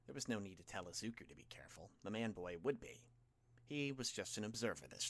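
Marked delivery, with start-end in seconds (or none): none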